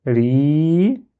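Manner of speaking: Ri with a long i that rises at the end; prolonged vowel. Shown as a demonstration. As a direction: stretch the i in "Ri", letting it lift. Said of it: "pour prolonger ce qui vient avant".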